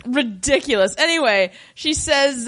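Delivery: ordinary talk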